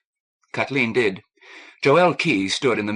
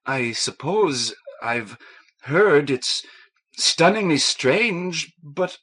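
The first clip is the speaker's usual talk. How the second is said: near tears; 6 semitones higher, much slower than usual. A narrator's reading. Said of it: "tears glimmered forth".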